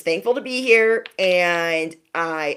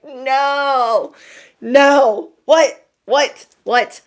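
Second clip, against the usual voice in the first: shocked disbelief; 7 semitones higher, 5 dB louder, much slower than usual. Retold or imitated. Shocked reaction to skincare advice.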